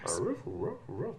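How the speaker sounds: whispered little barks; soft dream barking. Retold or imitated. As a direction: woof softly like a sleeping dog. Imitating dog's dream barks.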